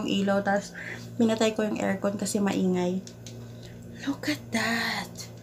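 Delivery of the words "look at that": slow, much softer awed whisper; hushed amazed whisper; whispered awe. Real, not acted. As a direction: breathe it out in wonder. Admiring gift quietly.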